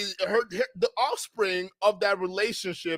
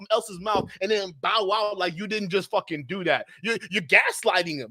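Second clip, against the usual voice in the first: ordinary talk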